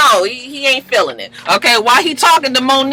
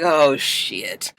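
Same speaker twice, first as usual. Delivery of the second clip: retold dismay; 9 semitones lower, 7 dB quieter than usual. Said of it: "Cisco looking like".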